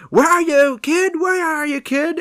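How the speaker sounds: loud, high searching holler; mock calling out. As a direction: imitate a villain calling for a hiding child. Retold Santa hunting for hiding child.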